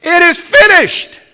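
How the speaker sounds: high, loud, slow anguished cry; a great loud cry of victory. Retold or imitated. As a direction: cry it out from the cross. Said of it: "he cries out".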